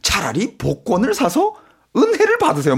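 lightly voiced hawker sales pitch; snake oil seller. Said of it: "야야야 이런 느낌".

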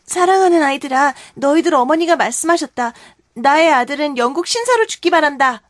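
brave Wendy, solemn farewell. Acted. Wendy gives the children her last words, resolute. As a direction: declare it proudly to the children.

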